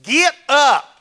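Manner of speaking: loud, high barked order; commanding shout. Acted. Preacher voicing the Lord's order to Joshua, shouted. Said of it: "Look what he says".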